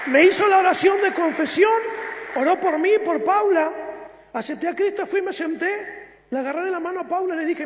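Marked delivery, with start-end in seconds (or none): 0.1-3.7 loud testimony, louder than before; preaching shout. Real, not acted. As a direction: proclaim it to the congregation. Preacher telling testimony at full force.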